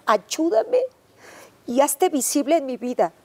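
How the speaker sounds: woman in despair begging God to save her. Retold or imitated. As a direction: beg for rescue with rising desperation. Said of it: "le reclamé a Dios gritándole".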